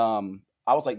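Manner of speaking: ordinary talk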